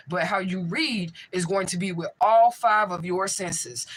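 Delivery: ordinary delivery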